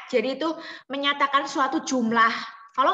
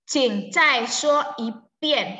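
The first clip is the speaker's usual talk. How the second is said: slow, clear Mandarin model sentence; slow model reading; 5 dB louder, slower than usual. Shown as a demonstration. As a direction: read it slowly for students to copy. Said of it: "teacher models sentence".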